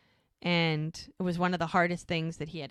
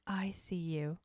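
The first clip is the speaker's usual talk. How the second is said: soft whispered words; 5 dB quieter than usual. Retold or imitated. She whispers to Jack after the kiss. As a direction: breathe it close, like a secret. Tender moment before a kiss.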